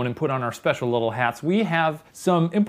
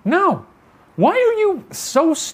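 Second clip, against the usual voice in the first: high-pitched protest; 3 dB louder, slower than usual. Real, not acted. Protesting being moved.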